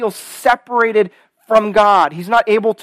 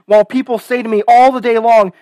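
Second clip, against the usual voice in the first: heartfelt pulpit reading, pushed louder; scripture reading; 4 semitones higher, 5 dB louder than usual. Acted. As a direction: read out the lament with strong, stretched key words. Reads the psalm aloud.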